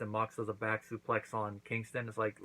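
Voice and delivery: ordinary talk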